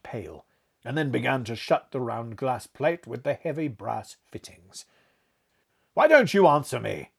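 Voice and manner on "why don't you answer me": rough demand. A narrator's reading. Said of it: "I said roughly".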